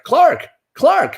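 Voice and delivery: mock shout of the hero's name; calling Clark. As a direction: shout the name up high like a gawking bystander.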